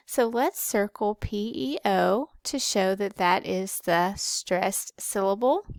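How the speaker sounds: ordinary talk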